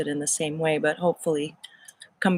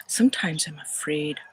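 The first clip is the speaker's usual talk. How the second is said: a hushed, fearful whisper in Irish lilt; afraid whisper; 2 semitones lower than usual. Acted. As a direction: whisper it as if confessing a fear. List of feelings, whispered.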